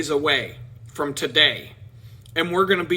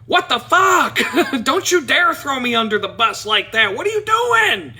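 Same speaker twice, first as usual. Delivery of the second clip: shocked outburst; 9 semitones higher, 6 dB louder than usual. Retold or imitated. Friend exposes him to teller.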